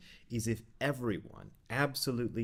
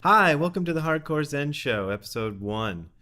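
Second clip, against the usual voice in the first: announcer intro; 6 semitones higher, 9 dB louder than usual. Acted. Host opening the show.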